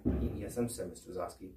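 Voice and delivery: put-on a scared kid forcing out a lie; nervous. Nervous under questioning.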